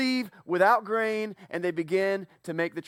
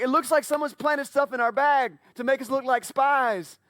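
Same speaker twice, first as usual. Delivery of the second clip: higher, louder, rattled; freaking out; 6 semitones higher, 5 dB louder than usual. Acted. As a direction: brothers panicking to each other about being framed. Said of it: "suddenly they're freaking out".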